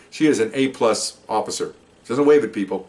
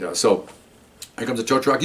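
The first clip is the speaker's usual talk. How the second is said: ordinary talk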